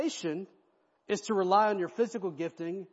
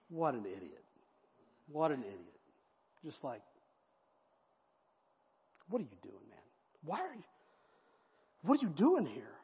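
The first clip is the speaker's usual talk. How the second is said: muttering Cushi; 2 semitones lower, 5 dB quieter than usual. Acted.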